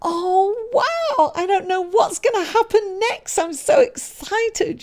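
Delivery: breathless wonder, the last words slowed; excited Fool. Acted. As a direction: gush like a carefree wanderer. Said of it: "the Fool's energy is like".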